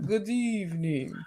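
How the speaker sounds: lightly voiced vampire greeting; soft, ominous count's welcome; Dracula voice. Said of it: "The dude Dracula thing".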